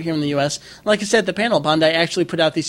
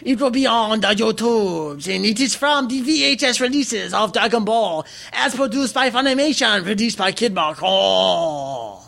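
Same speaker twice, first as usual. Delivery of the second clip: mock Chinese old man; 5 semitones higher than usual. Host crediting the VHS releases as a joke, mock Chinese old man. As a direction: keep up the caricature Chinese narrator accent. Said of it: "you and Andrew doing voices".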